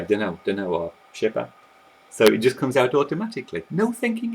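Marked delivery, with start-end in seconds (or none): 0.0-0.7 dunno, words run together fast and casual; contracted dunno. Shown as a demonstration. Teacher shows how people shorten I don't know, casual. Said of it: "you'll contract this". 1.1-1.5 Je sais pas said as a quick casual shi pa; contracted French. Shown as a demonstration. Teacher shows casual French for I don't know, slurred. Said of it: "you'll contract this".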